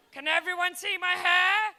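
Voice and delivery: loud crowd-work question; calling out. Real, not acted. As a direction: project it to the back rows. Calling out to whole room.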